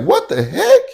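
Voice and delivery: high, stunned what the heck; retold shock. He reacts in disbelief to the hit and run accusation, pitched high. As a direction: squeak out disbelief.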